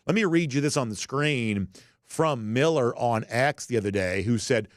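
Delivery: ordinary delivery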